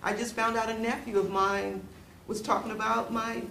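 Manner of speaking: ordinary delivery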